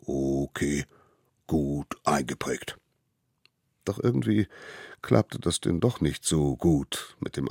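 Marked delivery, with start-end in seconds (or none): 0.1-2.8 low, easy-going beast; a hint of a slow mammoth; lightly voiced mammoth. Mammoth agrees to the little hunter, lightly low.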